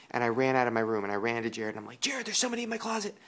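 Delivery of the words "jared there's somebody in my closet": fast, high, frantic report; panicked. Retold or imitated. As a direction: blurt it out in a panic, much higher.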